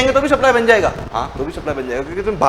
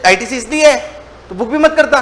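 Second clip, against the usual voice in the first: higher, louder mock scolding; government scolding; 5 semitones higher, 6 dB louder than usual. Government scolding the teacher for booking ITC, mock angry. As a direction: scold him like an irritated tax officer. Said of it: "तो बोले".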